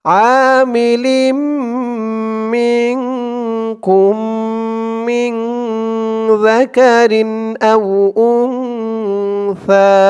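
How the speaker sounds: slow melodic tajweed chant; Quran recitation. Acted. Teacher reciting the verse for students to follow. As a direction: recite it with held nasal ghunnas.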